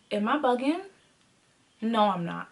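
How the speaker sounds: thinking to oneself. Retold or imitated. Woman questioning herself alone at work, quiet self-talk. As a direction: quietly question yourself, then answer low. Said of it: "I'm just thinking to myself".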